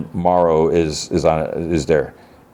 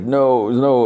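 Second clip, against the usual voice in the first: high-pitched imitation of distress, no real sobs; whimper-like mock denial, pitched high; tearful; 7 semitones higher than usual. Boy mourns dead man, high acted distress. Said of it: "he starts, like, crying".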